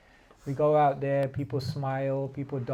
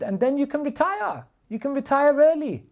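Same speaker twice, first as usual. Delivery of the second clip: much higher, eager pitch; eager businessman; 10 semitones higher, 5 dB louder than usual. Retold or imitated. Businessman promising the boatman early retirement, voice pitched high. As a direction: dangle the dream of retiring early. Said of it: "And then he said".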